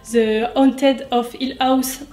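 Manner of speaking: slow, exaggerated English accent; hammed-up English accent; mock English accent. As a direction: savour the English title with a put-on accent. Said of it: "avec mon superbe accent".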